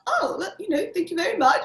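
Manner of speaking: a casual British thank-you, only hinted; British accent. Retold or imitated. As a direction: modest British actor's thanks, high and light. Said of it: "in his British accent".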